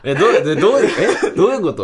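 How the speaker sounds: laughing confusion. Retold or imitated. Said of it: "っつって".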